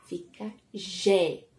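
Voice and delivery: slow, careful "Gé"; syllable demo. Shown as a demonstration. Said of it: "O G com a vogal E".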